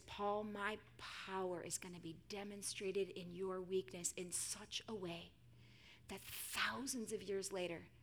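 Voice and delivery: soft, low promise fading toward a whisper; gentle, intimate divine voice; hushed reassurance. Acted. God reassuring Paul, voiced hushed.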